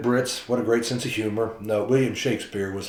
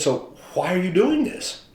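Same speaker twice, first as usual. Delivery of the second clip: higher, slow, wondering question; curious reporter; 9 semitones higher than usual. Retold or imitated. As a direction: ask it in disbelief.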